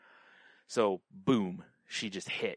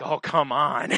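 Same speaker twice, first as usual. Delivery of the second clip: exasperated; 5 semitones higher, 10 dB louder, slower than usual. Real, not acted. Long line at the bathroom.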